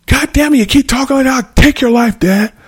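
high-pitched enraged outburst; furious threat. Acted. Child threatening dad in a food quarrel, high and enraged. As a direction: threaten at the top of your pitch.

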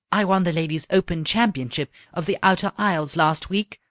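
lightly voiced princess. A narrator's reading. Princess voiced in read story.

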